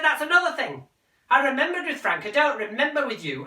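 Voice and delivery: high, loud, indignant; angry mother. Acted. Mother rounding on son, high and angry. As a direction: hit back at him loudly.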